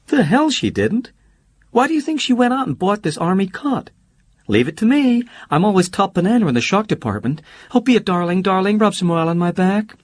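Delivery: Holly's bright voice. Acted. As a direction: play Holly teasing and carefree.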